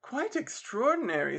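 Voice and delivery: lightly voiced lady, pitched high; a touch of a sigh, high and ladylike. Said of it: "sighed the military gentleman's lady".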